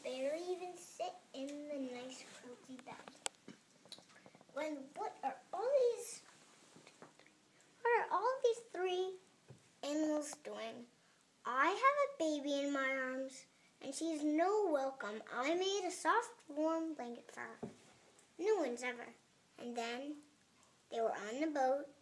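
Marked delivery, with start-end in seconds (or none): 4.5-10.9 surprised. Acted. Angel surprised at animals. 11.5-19.1 loud. Acted. Character speech, loud.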